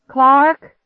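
loud, high panicked call; alarmed shout of a name. A narrator's reading. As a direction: yell for someone in another room. Calling out for brother in panic.

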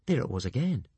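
ordinary delivery

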